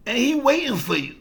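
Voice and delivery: half-yelled coaching, fed up with him charging straight in; exasperated manager. Retold or imitated. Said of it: "He said".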